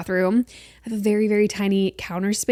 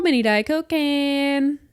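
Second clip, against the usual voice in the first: announcer; 6 semitones higher, slower than usual. Pretend. Repeating the announcement.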